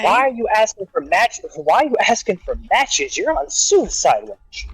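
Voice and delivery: high, slowed disbelieving question; can't believe what you're hearing; mock-exasperated you're on suicide watch; incredulous. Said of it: "we go up and say".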